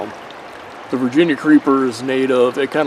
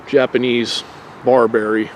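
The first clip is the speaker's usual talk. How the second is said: usual speaking voice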